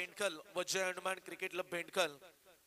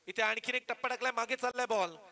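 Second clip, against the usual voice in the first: excited call; 4 semitones higher, 6 dB louder than usual. Real, not acted. Commentator calls a shot.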